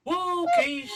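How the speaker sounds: high, loud, sing-song call; calling the dog. Acted. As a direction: belt it out to the dog.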